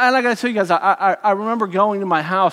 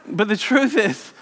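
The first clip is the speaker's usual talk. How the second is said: normal talking voice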